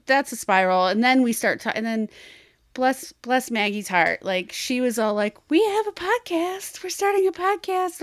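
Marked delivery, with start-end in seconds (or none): 5.5-8.0 higher, eager announcing; excited quote. Retold or imitated. Retelling how Maggie eagerly announced her podcast, excited. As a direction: gush the news like an excited friend.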